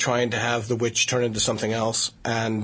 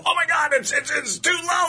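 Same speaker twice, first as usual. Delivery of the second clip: shocked client. Retold or imitated. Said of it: "they go".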